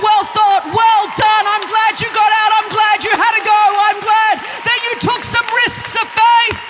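a light mock Jesus voice celebrating; Jesus cheering her on. Imagined Jesus praising her risks, high and loud.

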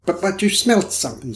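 high-pitched, stammering reproach; reproachful, stumbling on the first word. A narrator's reading. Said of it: "said Mister Middleton reproachfully".

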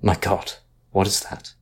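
hoarse, hushed cry of horror; hoarse exclamation. Acted. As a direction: rasp it out, stunned with dread. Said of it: "exclaimed hoarsely".

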